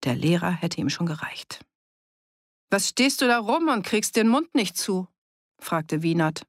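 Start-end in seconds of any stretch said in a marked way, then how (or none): 2.7-5.1 higher-pitched, mildly taunting question; challenging. A narrator's reading. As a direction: confront him with a pointed question. Wienert stellt Fritz vor allen zur Rede. (herausfordernd nachfragen)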